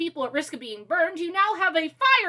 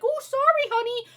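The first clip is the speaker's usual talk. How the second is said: high, meek little imitation; exaggeratedly sweet and submissive, pitched way up; mock apologetic dad; 9 semitones higher than usual. Dad apologizing to his rude daughter, mocking high meek voice. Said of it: "her dad's response was be like".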